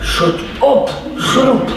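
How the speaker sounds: high, strained telling-off without volume; irritated hushing. Acted. Crowd trying to silence the shouting beggar, high irritated hiss. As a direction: silence him urgently, half under control.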